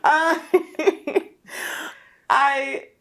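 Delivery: high giggly stammer; laughing through it. Real, not acted. Guest still laughing while pushed to speak. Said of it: "Spit it out".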